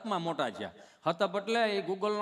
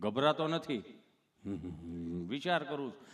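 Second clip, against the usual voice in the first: low, halting stammerer; tongue-tied Gabho; 9 semitones lower, 4 dB quieter than usual. Retold or imitated. Gabho telling the nurse he's thinking, low stammer. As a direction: stick before each phrase, pitched low. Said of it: "ચોંટે અટકે".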